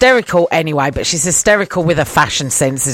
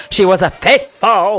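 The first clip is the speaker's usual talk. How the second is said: hammed-up Scottish accent on one word; mock-dramatic Scottish flourish; Alan Cummins's Scottish accent. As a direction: draw it out in theatrical Scottish. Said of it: "sounds like he's putting on a Scottish accent".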